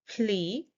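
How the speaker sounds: "plea" drawn out as the stressed syllable. Shown as a demonstration. Teacher showing which syllable we stress, stressed. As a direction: lean on "plea" with a long ee. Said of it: "We stress on this syllable".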